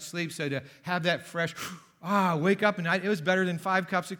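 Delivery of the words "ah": sharp gasp, then a louder "ah"; mock cold-shower gasp. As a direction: imitate the shock of icy water. Acts out cold shower shock.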